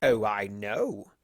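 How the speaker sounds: slow, sly purr; grinning slow villain tone; wicked grin. A narrator's reading. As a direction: savour it wickedly. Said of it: "he said with a wicked grin".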